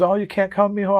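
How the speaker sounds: high, disappointed mother voice; mother asking her son why he can't come; imitating mom. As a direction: lightly play his mother, let down. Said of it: "so she's".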